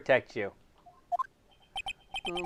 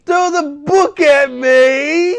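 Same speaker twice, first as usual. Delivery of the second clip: loud pleading shout, higher; screaming; 10 semitones higher, 8 dB louder, slower than usual. Acted. Ron screams his guilt to the courtroom, crying shout. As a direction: yell out a guilty plea, slowed. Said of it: "Who's that screaming like that?".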